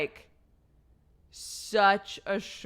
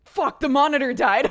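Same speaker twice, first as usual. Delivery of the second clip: much louder, higher panicked curse; husband discovering the dead baby monitor, loud alarmed curse; 8 semitones higher, 13 dB louder than usual. Retold or imitated. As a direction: blurt it out in alarm. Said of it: "and he was like".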